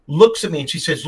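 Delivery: usual speaking voice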